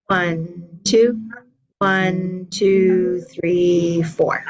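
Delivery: steady counting the beat aloud; counting along. Shown as a demonstration. Teacher counting rhythm while the class claps. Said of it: "Let's go".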